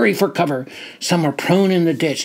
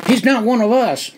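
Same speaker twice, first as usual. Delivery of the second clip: higher, alarmed accusing shout; 6 semitones higher than usual. Acted. Captain alerting the other captain, shouting. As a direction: raise the alarm on the intruder. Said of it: "he reached for my jacket and shouted".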